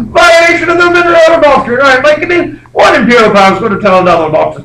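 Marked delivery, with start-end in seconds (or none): 0.0-2.0 high, loud sarcastic scoff with a laugh; mocking English dismissal. The English scoffing at the American warning, mocking and loud. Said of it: "the English are like".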